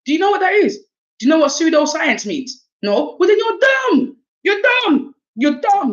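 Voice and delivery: high-pitched, mock-outraged quizzing; outraged comeback. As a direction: fire back at whoever called you mad. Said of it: "until you start calling us mad. We're like".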